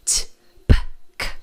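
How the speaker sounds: T, P, K as pops of air with no vowel; beatbox-like clicks of T, P, K; bare consonants. Shown as a demonstration. Teacher shows consonant-only sounds like beatbox, unvoiced. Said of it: "É tipo só a consoante".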